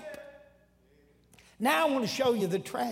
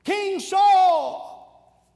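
loud, high long call; David hollering at the sleeping camp, loud and high; 8 semitones higher, 9 dB louder than usual. Retold or imitated. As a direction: call across the valley to the king. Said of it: "he hollers".

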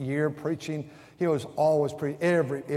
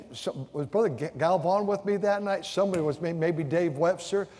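ordinary delivery

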